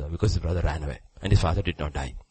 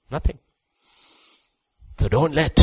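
ordinary talk, nothing marked

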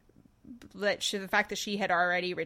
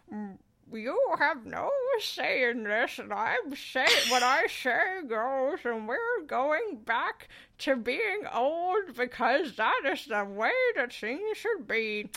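put-on slow, higher, creaky old codger; old man voice; 5 semitones higher, slower than usual. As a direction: play a stubborn old husband laying down the law. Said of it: "[name]'s old man voice".

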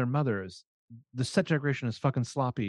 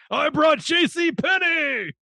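mock shout; 13 semitones higher, 5 dB louder, slower than usual. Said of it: "what's his name from Roadhouse being like".

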